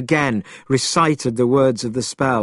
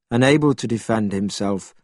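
usual speaking voice

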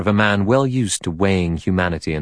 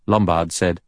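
usual speaking voice

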